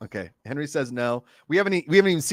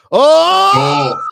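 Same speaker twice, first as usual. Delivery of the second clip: excited shout; 11 dB louder than usual. Real, not acted. Reacting to a boundary live.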